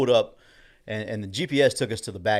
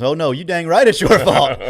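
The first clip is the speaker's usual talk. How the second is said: higher, louder, slower mock scolding; mock angry coach; 7 semitones higher, 9 dB louder than usual. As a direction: ham up an old coach's fake anger, slowly. Old coach playfully scolding him.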